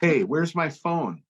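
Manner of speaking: string out the bait slowly; fake innocent question. Bandmate baiting a friend to look, high and slow.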